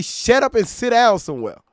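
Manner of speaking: loud scolding grandma, high and sharp. Retold or imitated. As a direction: bark it like a stern grandma. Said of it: "Like grandma say".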